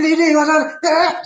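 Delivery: high, slow imitated call; retold calling out. Retelling his call to Lady D, high drawn-out call. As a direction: replay calling to someone, stretched and high. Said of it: "I said".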